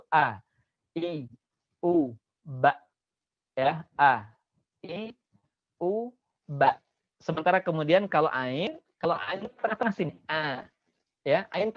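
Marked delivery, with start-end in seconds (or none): 0.1-6.8 A, I, U, ba from the far throat; throaty vowels; deep throat a. Shown as a demonstration. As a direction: open the throat low for A I U. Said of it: "A keluar dari tenggorokan terjauh".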